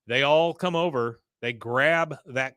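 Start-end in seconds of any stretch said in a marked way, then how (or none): none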